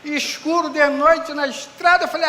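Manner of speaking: usual speaking voice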